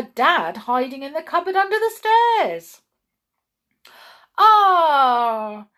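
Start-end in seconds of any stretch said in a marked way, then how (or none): 4.4-5.7 loud, high shopkeeper's welcome, drawn out; delighted exclamation. A narrator's reading. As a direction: greet favourite customers with a big exclamation. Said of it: "exclaimed Raj".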